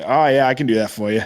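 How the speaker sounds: put-on easygoing Boston accent, Rs dropped. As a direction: imitate a Boston rental clerk. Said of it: "now I sound like a Kennedy".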